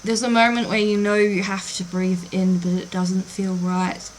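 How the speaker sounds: soft, even, immersive storytelling; hushed, measured scene-setting with lingering pauses; game master narration. Acted.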